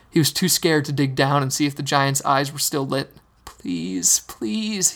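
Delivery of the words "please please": begging. Acted. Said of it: "he begged".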